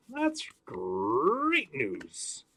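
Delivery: slow, stretched cartoon-mascot growl; hammed-up cereal mascot voice; Tony the Tiger. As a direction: draw out great like a cereal-box tiger. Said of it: "my Tony the Tiger impression".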